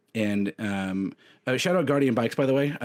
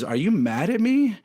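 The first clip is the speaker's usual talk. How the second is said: scared son; 9 semitones higher, 4 dB louder than usual. Retold or imitated. Son asks while crying.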